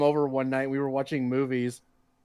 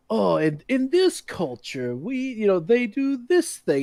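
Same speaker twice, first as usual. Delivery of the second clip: mock documentary voice; 6 semitones higher, slower than usual.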